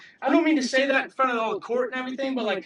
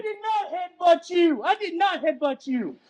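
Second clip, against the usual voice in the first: higher, slow shouted denial with NOT hit hard; angry shout; 7 semitones higher than usual. Real, not acted. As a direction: yell the denial at the officer. Denying headbutt accusation, shouting.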